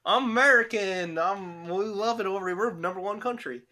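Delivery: hammed-up American accent, pitched a little up. Showing off the easiest accent, mock American accent. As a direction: put on an exaggerated American voice. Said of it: "I feel like the American accent's".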